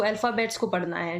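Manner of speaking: normal talking voice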